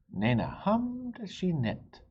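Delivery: plain reading voice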